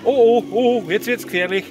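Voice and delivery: lauter, höher: oh oh, gefährlich; aufgeregt. Real, not acted. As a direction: spannungsgeladen ausrufen. Watching risky RC climb. (aufgeregt und gespannt)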